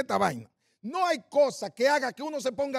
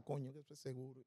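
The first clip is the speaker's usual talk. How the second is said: muttered dread; 8 semitones lower, 20 dB quieter than usual. Retold or imitated. Anxious thoughts in line.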